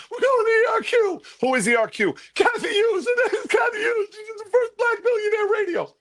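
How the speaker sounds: very high falsetto wail; mock-terrified squeal, far above normal; squeaky panicked voice. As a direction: squeak out answers in comic panic. Replays his own squeaky panicked answers.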